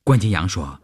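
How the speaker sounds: plain reading voice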